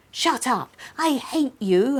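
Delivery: lightly voiced angry snap at a sister; furious. A narrator's reading. Girl lashing out at laughing sister, mildly furious. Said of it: "I said furiously".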